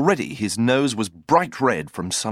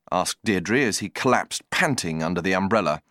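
ordinary delivery

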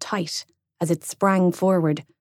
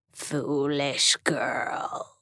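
very slow, low, soft croak; a dying demon croaking; 4 semitones lower, 7 dB quieter, much slower than usual. Acted. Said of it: "it croaked".

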